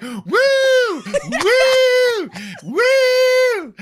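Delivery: high, loud mock fan squeal; hammed-up woo of a thrilled woman fan; excited fan woo. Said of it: "and she's like".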